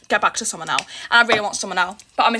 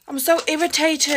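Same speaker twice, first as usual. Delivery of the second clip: normal talking voice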